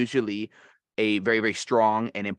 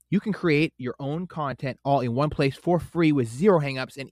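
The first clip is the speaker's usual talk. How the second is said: upbeat, higher sponsor read; 4 semitones higher than usual. Acted. Host pitching the podcast app to listeners, bright ad read. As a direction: sell the app like a radio spot.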